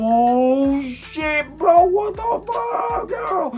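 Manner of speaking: very high, loud, slow disbelieving yell; shocked shout. Retold or imitated. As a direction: scream it like you can't believe the old lady. Narrator's shocked inner shout.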